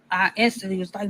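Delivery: ordinary talk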